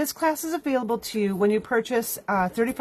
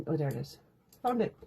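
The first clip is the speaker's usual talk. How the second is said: normal talking voice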